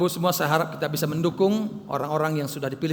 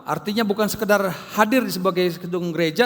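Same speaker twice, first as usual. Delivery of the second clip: loud, driving sermon delivery, higher and louder; preaching at full force; 5 semitones higher, 7 dB louder than usual. Acted. Pastor preaching loudly to his congregation about bearing fruit. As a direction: hammer the message home to the church.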